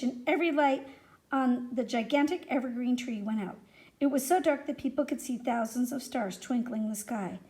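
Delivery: ordinary delivery, nothing marked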